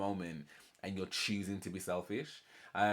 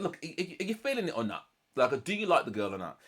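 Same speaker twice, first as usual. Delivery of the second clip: higher-pitched pressing questions; forensic questioning; 6 semitones higher, 3 dB louder than usual. Retold or imitated. Jasmine grilling him about the girl, pushy questioning. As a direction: imitate her insistent grilling. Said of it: "being quite forensic".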